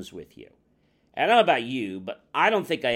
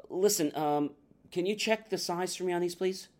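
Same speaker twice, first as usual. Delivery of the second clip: higher-pitched, careful polite request; politely change the subject, voice lifted; trying to be polite; 6 semitones higher, 3 dB quieter than usual. Acted. Customer dodging personal questions from the shoe salesman, strained politeness. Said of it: "you're trying to be polite".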